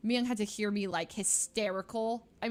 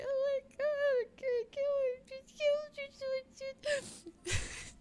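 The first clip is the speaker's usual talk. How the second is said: high, squeaky crying words; hysterical sobbing; slower than usual. Retold or imitated. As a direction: re-enact sobbing too hard to get words out. Retelling sobbing over her limp cat.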